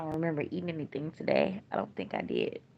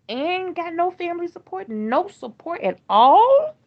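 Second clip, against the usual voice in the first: anguished outburst; 11 semitones higher, 13 dB louder, slower than usual. Real, not acted. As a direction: voice jumps high and loud in pain. Grieving parent, no family support.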